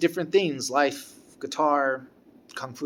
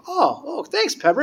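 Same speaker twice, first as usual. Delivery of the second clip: surprised thanks; 12 semitones higher than usual. Retold or imitated. Receiving gift.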